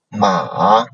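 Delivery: falling-rising MA; third tone. Shown as a demonstration. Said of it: "third tone".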